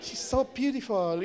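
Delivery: put-on high, gushing Filipina accent; Filipina friend. Friend praising the singer, high Filipina accent. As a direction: imitate a doting Filipina friend, laughter underneath. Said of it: "a Pinay, was a Filipina".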